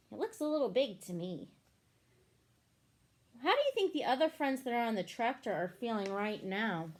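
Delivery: ordinary delivery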